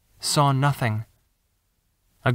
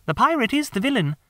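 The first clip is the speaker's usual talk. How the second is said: put-on high girl voice, matter-of-fact; a sister explaining the obvious; young girl; 9 semitones higher than usual. Wendy tells John the pirate is the villain, high girl voice.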